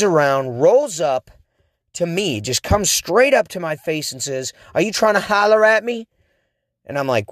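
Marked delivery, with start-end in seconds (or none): 4.7-6.1 imitating a tough girl, pitched higher; mock street-tough challenge, pitched up; gangstery girl. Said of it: "kind of a little bit gangstery".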